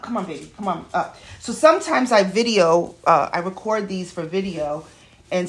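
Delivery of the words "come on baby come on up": much softer, gentle coax; tender pet talk. Real, not acted. Coaxing puppy onto lap.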